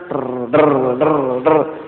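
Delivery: low buzzing drr like a vibrating phone; phone vibration buzz. Shown as a demonstration. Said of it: "ಹೀಗೆ ಮಾಡಿದ್ರೆ ನೋಡಿದ್ರ".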